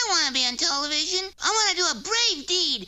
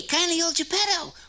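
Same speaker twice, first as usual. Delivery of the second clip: normal talking voice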